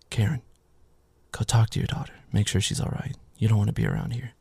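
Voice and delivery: calm her down and send her off quietly; hushed reassurance. Retold or imitated. Man quietly telling the mother to check on her daughter, gently. Tommy calmly sending Karen away.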